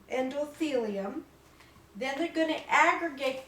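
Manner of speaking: normal talking voice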